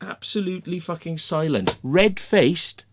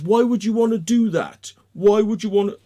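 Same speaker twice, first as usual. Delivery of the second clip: louder, higher mock-indignant question; imitated challenge; 4 semitones higher, 5 dB louder than usual. Man questioning the threat to shut it down, loud mock indignation. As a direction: mimic someone demanding an explanation. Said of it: "And he says".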